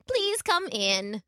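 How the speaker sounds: put-on slow, high old-lady voice; welcoming grandmother. A narrator's reading. Grandmother letting in her visitor, slow and welcoming. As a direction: invite slowly, pitched high.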